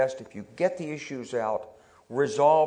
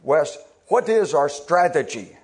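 put-on slow, louder Polish-accented general; 3 semitones higher, 7 dB louder than usual. Boss General Shali Kashvili quizzing Wes, slow loud Polish accent. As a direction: put on the boss's Polish accent, slowly and firmly. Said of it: "with his Polish accent".

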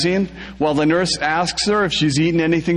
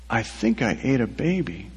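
quiet confession; 5 semitones lower, 6 dB quieter than usual. A narrator's reading. Girlfriend confessing pregnancy quietly.

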